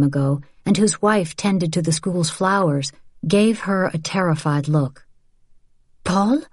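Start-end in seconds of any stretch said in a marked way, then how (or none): 6.0-6.4 a sharp call of his name; said sharply. Acted. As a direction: cut in sharply to stop him. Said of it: "Vianne said sharply".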